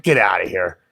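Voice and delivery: low-pitched brush-off; dismissive scoff. Retold or imitated. Narrator to frightened driver, dismissive. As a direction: scoff in a dropped voice.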